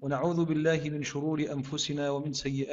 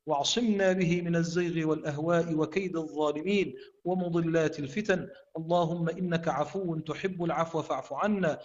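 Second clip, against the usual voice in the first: fervent supplication, raised and sustained; prayer; 4 semitones higher, 5 dB louder than usual. Acted. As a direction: pray earnestly to God. Closing prayer after Quran recitation.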